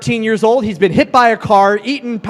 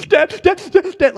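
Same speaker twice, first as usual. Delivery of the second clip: higher, choked calling of Dad; imitating crying son; 9 semitones higher than usual. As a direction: mimic a sobbing boy who can't get words out. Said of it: "trying to speak through the tears".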